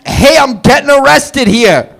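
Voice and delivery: put-on brash New York accent shout; New York criminal. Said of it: "a criminal from New York".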